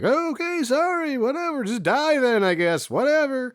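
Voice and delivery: high, dismissive shrug voice; mock huffy doctor. Rebuffed doctor giving up on the patient, high and huffy. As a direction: toss it off high and offended. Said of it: "she's like".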